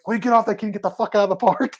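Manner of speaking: high, mock angry wife voice; wife yelling at kids. Wife chasing teens out of the park, mock angry. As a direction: impersonate a furious mom shooing teens. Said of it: "She's like".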